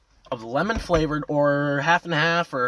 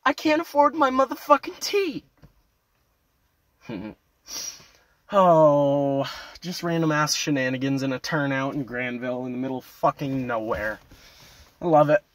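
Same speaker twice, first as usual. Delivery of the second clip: ordinary talk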